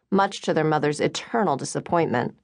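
ordinary delivery

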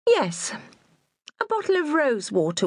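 lightly voiced lofty lady; a hint of a condescending lady; haughty lady voice. A narrator's reading. Haughty customer orders.

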